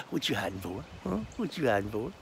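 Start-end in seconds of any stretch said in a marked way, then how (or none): none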